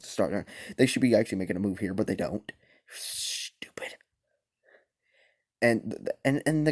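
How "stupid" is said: whispering. Real, not acted. Whispered aside.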